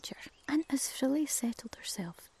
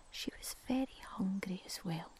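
ordinary delivery

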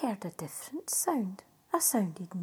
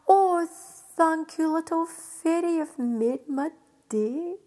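overjoyed thanks; 4 semitones higher, 8 dB louder than usual. Acted. Jack thrilled.

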